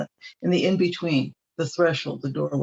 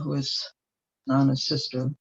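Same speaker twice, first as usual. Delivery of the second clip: ordinary talk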